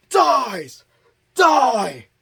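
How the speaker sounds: high, loud mock yell on each stab. Recapper voicing Tommy stabbing Jason, mock yell.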